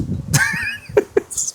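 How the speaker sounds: breathy high giggle at his own joke; high laugh. Real, not acted. Cook laughs at his own diarrhea joke, high giggle. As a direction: cackle after the punchline.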